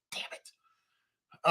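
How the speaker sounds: hushed, whispered curse; muttered frustration. Retold or imitated. Fan reacting to Dallas drafting him, muttered frustration. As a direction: swear under your breath.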